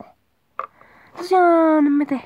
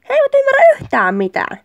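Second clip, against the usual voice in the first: fast, high-pitched griping; exasperated complaint; 11 semitones higher, 3 dB louder, faster than usual. Acted. Partner griping about men, exasperated. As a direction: rattle it off high, acting fed up.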